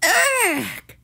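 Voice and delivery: high "ugh" of revulsion; disgusted cry. A narrator's reading. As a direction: cry out at something slimy. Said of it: "she cried".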